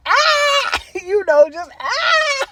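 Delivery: high squealing cackle with laughter; shrieking cackle. Shown as a demonstration. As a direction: let out a wild shrieking cackle.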